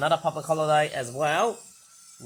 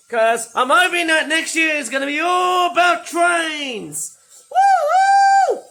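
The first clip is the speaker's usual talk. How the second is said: loud, high whoop of joy; excited cheer; 10 semitones higher, 9 dB louder, slower than usual. Real, not acted. Hoping next year is all about trains, cheering loudly. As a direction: let the excitement burst out into a cheer. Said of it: "Sorry if I'm sounding excited".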